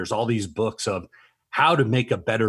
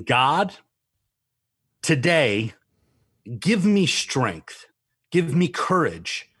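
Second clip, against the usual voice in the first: heartfelt prayer; 5 semitones higher than usual. Retold or imitated. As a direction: pray it out, building fervently. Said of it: "with you saying".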